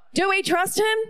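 ordinary talk, nothing marked

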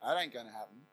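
low, soft, flat-out doubtful heart; doubting inner voice. Pretend. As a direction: mutter it to yourself, unconvinced. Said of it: "my heart says something like this".

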